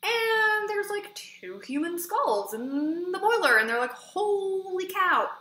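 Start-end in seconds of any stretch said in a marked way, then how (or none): 3.9-5.3 high, slow, stretched-out amazement; firemen's awed holy cow, acted out; drawn-out holy cow. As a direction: draw it out as the firemen gasp. Firemen find skulls in boiler.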